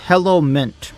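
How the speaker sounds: ordinary talk, nothing marked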